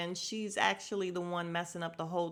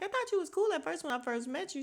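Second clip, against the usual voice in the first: fast, high-pitched mimicry; mock-disappointed, high and quick; imitating Sheree; 9 semitones higher, faster than usual. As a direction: rattle it off in her higher voice. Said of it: "then Sheree started talking".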